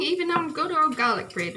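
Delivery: ordinary talk, nothing marked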